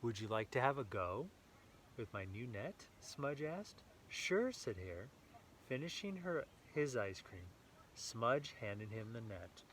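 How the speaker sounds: lively read-aloud storytelling voice; story narration. A narrator's reading. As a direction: read it brightly to a small child. Reading picture book to child.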